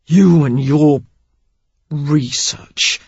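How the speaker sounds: research spat out like a dirty word, falling; man sneering research at the woman; research as if obscene. A narrator's reading. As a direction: sneer research as though it's filthy. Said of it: "as if it were obscene".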